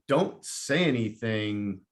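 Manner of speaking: ordinary talk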